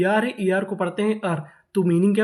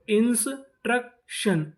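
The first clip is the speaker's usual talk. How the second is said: Instruction slow and higher, as इंस, truck, शन; syllable by syllable; 4 semitones higher, slower than usual. Shown as a demonstration. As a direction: sound out instruction in three parts. Teacher sounding out new word.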